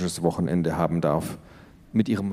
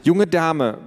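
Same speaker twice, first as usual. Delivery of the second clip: stern mother; 7 semitones higher, 4 dB louder than usual. Acted. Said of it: "begann Mama".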